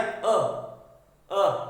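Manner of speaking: अ as a short, loud "uh"; vowel sound demo. Shown as a demonstration. Teacher giving the sound of letter U, short punched अ. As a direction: punch out अ on its own. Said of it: "उसी तरह जो यू है".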